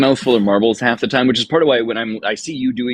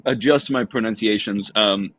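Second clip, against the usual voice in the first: normal talking voice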